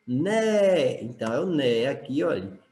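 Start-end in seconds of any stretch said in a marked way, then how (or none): 0.1-1.0 "Né" held long, higher than usual; stressed syllable. Shown as a demonstration. Teacher answering which syllable in neve is strongest, "Né" drawn out with stress. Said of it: "Qual a sílaba sorte em neve?".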